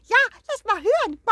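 high clucking hen voice. Acted. The hens greet the guest in high clucking voices. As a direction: chirp like a sleepy hen.

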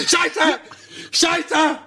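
hoher entsetzter Ausruf; entsetzter Aufschrei. Real, not acted. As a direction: schreck dich und flieg hoch. Schock nach Missgeschick. (entsetzter hoher Schrei)

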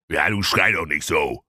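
a hint of a grumpy old king; lightly voiced king. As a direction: complain like a mildly annoyed king. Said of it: "sprach König Blumenkohl".

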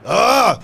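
battle cry. Acted.

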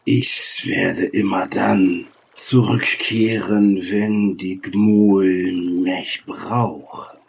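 slow, stretched pronouncement; solemn ancient sage. Acted. Sage promises to return for the Gmul, slow and solemn. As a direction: draw out each word with weight. Game master voices the sage.

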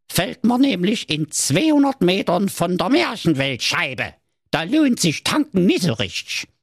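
grumpy dwarf. Acted. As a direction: drag it out sulkily, like a surly dwarf. Dwarf voiced in fairy tale.

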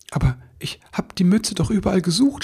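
mildly halting, confused little elf; stammering. Acted. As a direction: stammer a bewildered protest. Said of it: "stammelte er".

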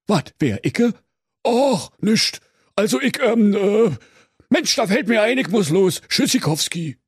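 high, flustered stammering sexton; caught-out Berlin sexton. Acted. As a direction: bluff your way out of the wardrobe. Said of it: "Und der Küster sprach". (hoher, ertappter, stotternder Küster)

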